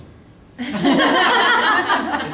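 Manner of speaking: high loud laugh; burst of laughter. Retold or imitated. Teachers laughing at the line.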